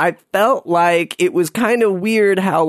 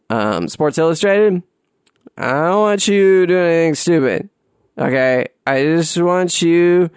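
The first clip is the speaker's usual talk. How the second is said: put-on slurred drunk rambling; blackout drunk. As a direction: lean in and slur like you're wasted. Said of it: "I'm so wasted".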